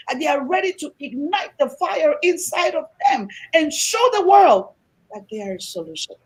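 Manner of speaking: usual speaking voice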